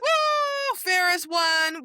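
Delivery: high, loud whoop of joy; excited cheer. Retold or imitated. Fan cheering the heroine's victory, loud and high. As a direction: cheer like your team just won.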